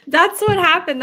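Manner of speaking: real laugh breaking through high and loud; can barely get it out for laughing. Real, not acted. Telling her viewers what happened, laughing hard.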